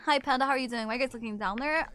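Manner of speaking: ordinary talk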